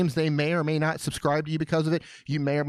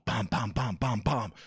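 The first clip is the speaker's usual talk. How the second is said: low, soft mock thumping; imitated wall banging; 5 semitones lower, 4 dB quieter than usual. Host imitates neighbor pounding on studio wall, soft low thumps. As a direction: tap out the neighbor's knocks. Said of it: "they'll beat on the wall".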